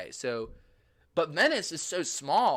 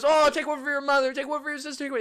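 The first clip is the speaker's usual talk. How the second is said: pushy seller; 12 semitones higher, 5 dB louder than usual. Retold or imitated. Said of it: "And then he goes".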